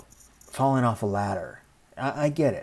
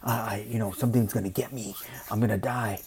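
much softer, breathless whisper of a scared shut-in; mock frightened whisper; 2 semitones lower, 5 dB quieter than usual. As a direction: imitate someone too afraid to go outside. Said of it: "I'm not saying we have to go".

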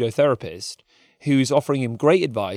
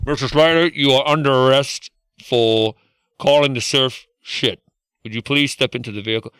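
mock police officer; 4 dB louder than usual. Mock police arrest of Slater.

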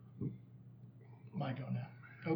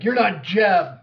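loud, raised in-character accusation; accusing shout; 4 semitones higher, 13 dB louder, slower than usual. Acted. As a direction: shout it as you see through the disguise. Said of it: "he's like".

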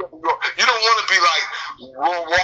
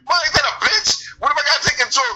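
mock indignant; 8 semitones higher than usual. Retells his own complaint at work.

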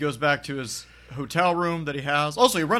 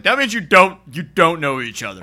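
raised, higher voice, hammering the point; loud, higher, heated retort; loud mock insistence; 5 semitones higher, 10 dB louder than usual. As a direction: shout it like an angry bluesman who's fed up. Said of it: "It's like".

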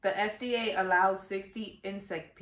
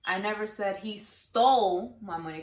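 stole punched, slow read; slow, landing on stole; stress on STOLE. Shown as a demonstration. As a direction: hit stole. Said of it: "the words that you stress".

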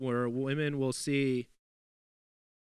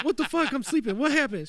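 higher, louder startled mock wake-up; confused sleepy self; 13 semitones higher, 8 dB louder than usual. Retelling waking up confused to his girlfriend, higher pitched. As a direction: play yourself jolted awake. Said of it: "I'm like".

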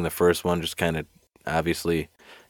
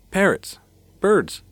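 squeaky high blurt of a bright idea; sudden idea; 13 semitones higher, slower than usual. Acted. Reporter desperately grabs an example for the chief, excited squeal. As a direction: pounce on the idea, pitch shooting up.

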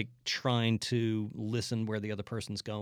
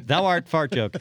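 higher, louder, laughing archaic actor; hammy stage actor; 8 semitones higher, 11 dB louder than usual. Pretend. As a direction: ham it up through laughter. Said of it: "an eighteen hundreds improv show".

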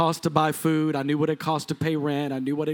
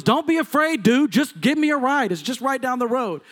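higher, louder pushy stranger asking for a ride; stranger's voice; 6 semitones higher, 5 dB louder than usual. Retold or imitated. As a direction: play the insistent man at the car window, pitched up and loud. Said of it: "And he goes".

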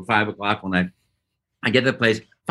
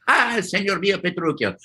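put-on theatrical old-comedy Italian accent, rising higher; Gremio, Italian accent; 7 semitones higher than usual. Actor recalling his Shakespeare role, nice Italian accent. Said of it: "spoke like this".

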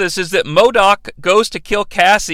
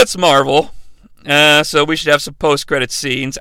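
normal talking voice

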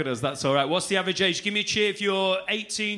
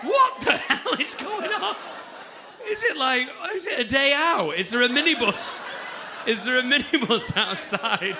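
mock astonishment; 6 semitones higher than usual. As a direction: ham up disbelief at the pensioners. Mock shock at old crowd.

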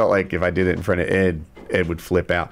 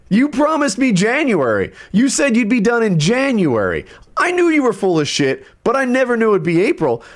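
retold rant; 12 semitones higher, 3 dB louder than usual. Homeowner ranting at contractor about the delay, furious. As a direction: let the anger boil over at the contractor. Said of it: "at one point I was like".

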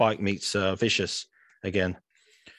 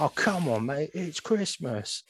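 Harry Enfield quote; 6 semitones higher than usual. Retold or imitated. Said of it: "he was like".

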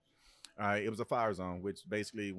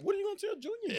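much higher mock voice; mocking the coach backing down; mock squeak; faster than usual. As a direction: squeak it in a silly put-on voice. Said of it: "mock voice of coach".

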